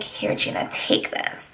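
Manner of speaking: hushed secret whisper of a friend passing a photo; whispered quote. Retold or imitated. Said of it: "She was like".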